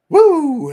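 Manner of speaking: cheer. Real, not acted. Cheering on air.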